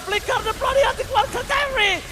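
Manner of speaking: excited play-by-play shouting; high, loud hype call; esports caster. Real, not acted. Caster yells live teamfight.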